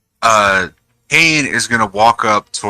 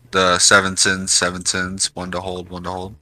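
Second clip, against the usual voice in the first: murmuring to self; 3 semitones lower, 7 dB quieter than usual. Acted. Player murmurs the phrase.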